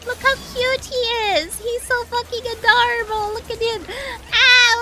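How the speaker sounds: high squealing gush over a cute pet; sweetly morbid. Real, not acted. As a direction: squeal with delight. Gushing over a cute creature.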